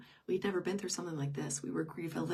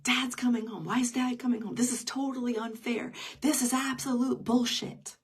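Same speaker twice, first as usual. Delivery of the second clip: louder, heated, each complaint hammered out; angry teen rant; 5 semitones higher, 8 dB louder than usual. Retold or imitated. Son storming in and ranting at his mother, furious and loud.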